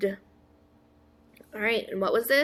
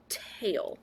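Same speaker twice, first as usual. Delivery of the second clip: whispered word; 2 semitones lower, 7 dB quieter than usual. Shown as a demonstration. Teacher whispering the word for children to repeat. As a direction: whisper "tail" softly, stop the voice. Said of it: "Say tail".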